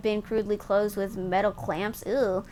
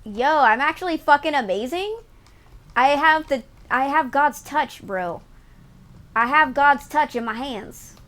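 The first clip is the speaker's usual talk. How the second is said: excited bragging; 5 semitones higher, 6 dB louder than usual. Real, not acted. Gamer brags after successful roll.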